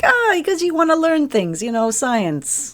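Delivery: breezy mock doctor, drawn-out ending; casual doctor. Quoting the game's doctor.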